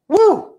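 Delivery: high, loud celebratory whoop; short whoop of excitement; excited woo. Real, not acted. Cheers after finishing teaching point.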